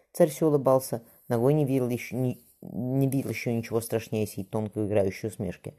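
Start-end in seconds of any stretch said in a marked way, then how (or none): none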